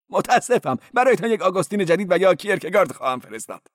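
high-voiced cleric. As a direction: play the cleric high and quick.

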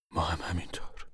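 unvoiced, breathy whisper. Young man to Papa, a whispered agreement. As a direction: breathe the words out without voice.

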